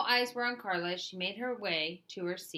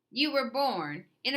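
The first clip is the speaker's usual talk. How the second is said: higher, louder sneer of a bully; 6 semitones higher, 6 dB louder than usual. A narrator's reading. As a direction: sneer it down at him. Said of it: "he sneered at".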